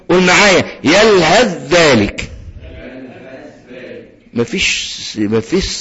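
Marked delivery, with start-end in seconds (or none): 0.8-2.3 slow يلهث ذلك, ث merged into a held ذ; doubled ذال. Shown as a demonstration. Teacher leads students after the rule, doubled ذال. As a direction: go from the هاء straight onto a doubled ذ in يلهث ذلك. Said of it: "مشددة".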